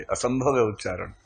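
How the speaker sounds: normal talking voice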